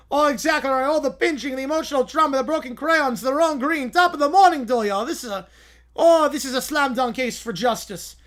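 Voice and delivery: high, loud pompous courtroom lawyer; hammy lawyer voice. Acted. To the judge, a blustering lawyer pleading his case. Acted lawyer in mock trial.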